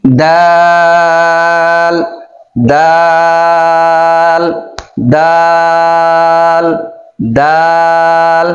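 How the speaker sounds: দাল with the vowel held four beats long; long drawn-out daal. Shown as a demonstration. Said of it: "এই শব্দটার নাম হচ্ছে দাল".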